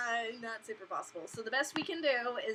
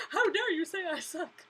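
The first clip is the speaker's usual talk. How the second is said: mock offended whine; 8 semitones higher, 4 dB louder than usual. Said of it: "She's like".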